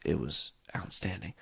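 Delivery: breathy whisper, barely there; whispered aside. Real, not acted. Whispering to listeners about the dinner, hushed. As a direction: lean in and whisper it like a secret.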